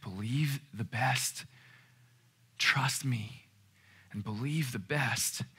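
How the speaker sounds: soft, slow, breath-light urging; hushed voice, spaced slowly; whispering. Acted. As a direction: whisper it as a gentle plea. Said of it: "because it's whispering".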